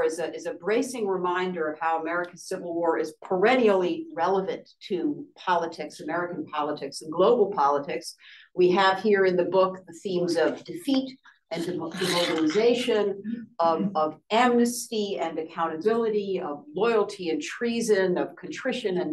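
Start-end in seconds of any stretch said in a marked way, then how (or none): none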